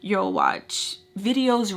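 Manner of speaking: ordinary talk, nothing marked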